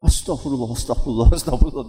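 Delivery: rapid repeated muttered plea for forgiveness; istigfar beruntun. Acted. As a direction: act a stunned father repeating it over and over. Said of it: "terus gitu". (istigfar berulang-ulang tanpa putus)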